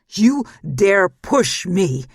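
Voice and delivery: a furious slow roar of challenge. Acted. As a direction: roar it at him, outraged. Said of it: "he roared".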